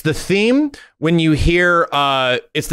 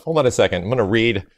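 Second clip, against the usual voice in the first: usual speaking voice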